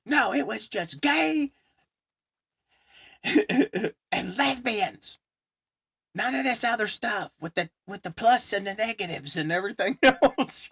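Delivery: high, loud and fed up; indignant rant. Retold or imitated. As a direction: hammer home the old days, laughing. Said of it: "He's like".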